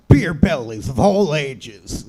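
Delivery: booming slow radio ad voice; monster truck ad. Acted. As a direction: announce it like a rally promo. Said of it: "radio promoting a monster truck rally voice".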